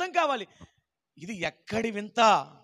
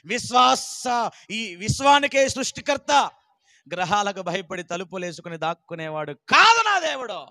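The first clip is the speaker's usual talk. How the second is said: preaching at full force; 8 dB louder than usual. Real, not acted. Preacher proclaiming Jesus the creator, shouting.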